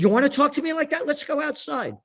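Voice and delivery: high, fast mock-tough challenge; retold challenge. Lawyer dares the speaker to step outside, high and fast. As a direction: throw down the dare, imitated.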